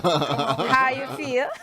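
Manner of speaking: slow Jamaican drawl breaking into laughter; laughing Jamaican accent. Shown as a demonstration. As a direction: draw it out in Jamaican, then laugh. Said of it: "I do my Jamaican accent".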